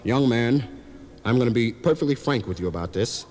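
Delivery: lightly voiced doctor, low and measured; understated low professional voice. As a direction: deliver grave advice as a frank physician. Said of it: "Doctor said".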